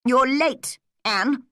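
strict schoolmaster's reprimand; stern reproach. Acted. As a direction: call out the late pupil sternly. Teacher scolds late pupil.

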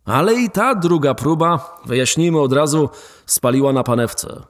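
ordinary talk, nothing marked

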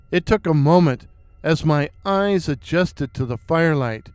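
plain reading voice

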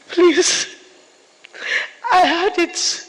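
loud plea. Retold or imitated. Mother's spirit begging her daughter.

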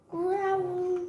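a small playful bear growl. Acted. Child recites the animals again, mock growl.

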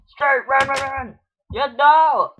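high, frantic gamer calls; urgent panic. Real, not acted. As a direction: urge yourself on as you flee. Fleeing in the game.

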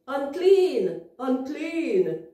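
mournful call, high and loud; acted sad voice. Shown as a demonstration. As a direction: model a sad cry for children. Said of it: "in your real sad voice".

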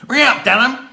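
very loud, high Dorset holler; broad, barely intelligible Dorset shout; Dorset dialect. Retold or imitated. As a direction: imitate an old villager calling out in broad Dorset. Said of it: "The Dorset dialect in Witchampton was different".

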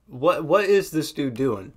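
ordinary delivery

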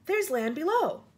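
excited high-pitched cry; excited shout. A narrator's reading. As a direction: call out the sighting, pitched well up.

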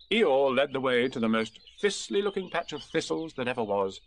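ordinary delivery

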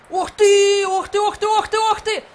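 high, loud repeated gasps of excitement; excited high yelp. Real, not acted. Gamer yelping at a tense save. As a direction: yelp in disbelief. Dramatic moment in match.

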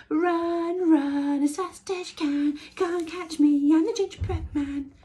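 high, loud teasing chant; shouting taunt. A narrator's reading. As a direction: shout the taunt over your shoulder. Gingerbread man taunting again.

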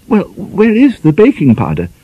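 high, fluttering, flustered lady's voice; dithering over the baking powder tin; fussy spinster mistress. Acted. Flustered mistress giving her maid orders.

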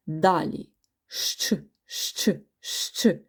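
щ drawn out as a long hushing shch sound. Shown as a demonstration. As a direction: hiss out the щ three times. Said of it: "Чуємо два звуки приголосні".

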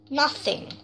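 normal talking voice

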